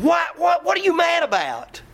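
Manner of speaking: shouted high, half-hammed challenge; mock angry shout. Preacher mocking an angry question to the congregation, hammed-up shout. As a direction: bark the question like a riled-up churchgoer.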